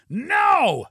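high, forceful cry; terrified scream. A narrator's reading. Passenger refuses another attempt, terrified scream. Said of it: "screamed Neil".

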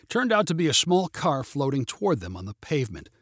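plain reading voice

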